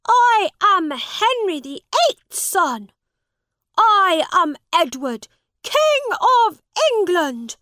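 high, loud royal proclamation; boy shouting he is the king; proud shout. A narrator's reading. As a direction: declare it like a king. Prince proclaims himself king.

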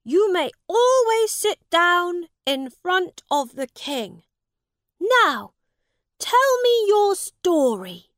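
put-on high, loud young prince voice; granting a royal privilege; lofty child royal command; commanding boy king. A narrator's reading. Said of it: "Edward said".

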